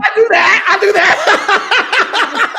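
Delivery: shouted laughing reply. Real, not acted. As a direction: laugh it out loud. Laughing at the praise.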